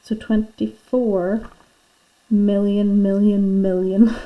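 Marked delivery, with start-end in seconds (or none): 2.3-4.3 louder, breaking into a laugh; tickled by a huge number; amused repetition. Real, not acted. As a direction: pile up each "million", then laugh.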